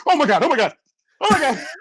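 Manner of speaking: high, frantic repeated oh my God; rising alarm that keeps climbing; mock panic. Rookie thinking he missed his team, high frantic panic. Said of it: "You're like".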